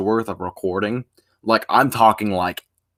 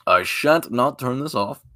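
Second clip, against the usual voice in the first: ordinary talk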